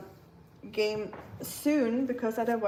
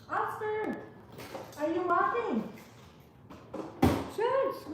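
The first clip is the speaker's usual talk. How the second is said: high-pitched voice, softer than usual; calling the dog; 8 semitones higher, 6 dB quieter, slower than usual. Real, not acted. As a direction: talk high and light to a dog. Talking to a dog named Oscar.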